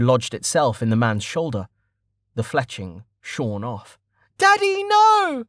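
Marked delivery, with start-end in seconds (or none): 4.4-5.4 put-on very high, drawn-out child's scream; girl's scream. A narrator's reading. Girl seeing her father fall, high scream. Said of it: "the girl screamed".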